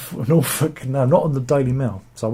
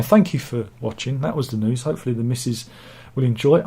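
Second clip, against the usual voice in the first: ordinary talk, nothing marked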